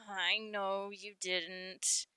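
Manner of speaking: slow, soft sigh into the words; resigned. Acted. Guppy answers Boulder's apology, softly resigned. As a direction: let it out on a tired sigh, hushed.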